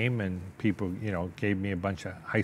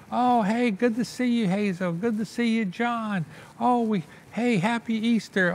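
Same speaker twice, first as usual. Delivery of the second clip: much higher, cheery hostess voice; aunt's greeting; 13 semitones higher, 4 dB louder than usual. Retold or imitated. Aunt greeting Hazel and John at Easter, much higher cheery voice. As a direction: put on a bright high lady voice saying hello.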